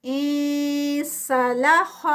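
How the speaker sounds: انسلخ drawn out with a hidden nasal نون; slow Quranic recitation. Shown as a demonstration. Teacher recites the word slowly for the class. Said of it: "یہاں پر بھی اخفا ہے".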